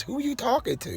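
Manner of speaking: high, soft mimicking question; imitating nosy sister. Brother mimics sister questioning his filming, high mock voice. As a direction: pitch it way up and drop the volume, mocking her. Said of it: "The next thing she say is".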